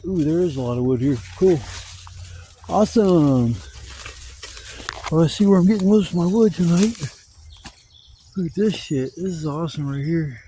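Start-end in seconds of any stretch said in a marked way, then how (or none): none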